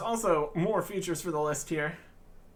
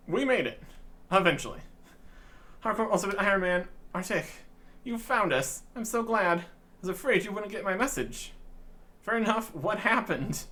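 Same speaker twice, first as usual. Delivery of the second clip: a higher, relieved little fairy; 6 semitones higher than usual. Acted. Fairy greeting the adventurer, lightly higher relieved voice. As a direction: voice the glad fairy a bit higher.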